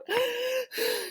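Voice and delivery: sharp, strangled gasp for air; choking gasp. Acted. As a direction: gasp like a boy who suddenly can't breathe. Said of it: "started huffing and puffing".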